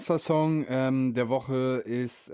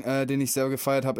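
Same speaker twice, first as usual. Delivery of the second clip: normal talking voice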